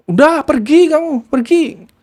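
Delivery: high, slow, mock-angry dismissal; angry nephew. Nephew shouting his aunt away from the door, slow and high. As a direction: drive her off, slowly and high.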